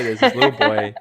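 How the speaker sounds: laughing while starting a story. Real, not acted. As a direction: chuckle through the opening line.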